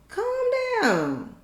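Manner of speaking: high exasperated plea. Real, not acted. Scolding the character.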